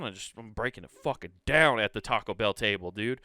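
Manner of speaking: ordinary talk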